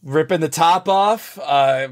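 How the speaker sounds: normal talking voice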